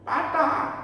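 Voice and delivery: high, alarmed mother's question; startled mother. Acted. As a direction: ask like a shocked mother. (ibu yang kaget, suara tinggi)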